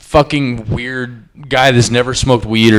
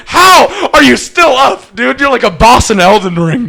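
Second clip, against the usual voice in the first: loud disbelief; 11 semitones higher, 9 dB louder than usual. Retold or imitated. Podcaster reacts to the man who won't stay down, incredulous. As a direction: exclaim it in hammed-up astonishment.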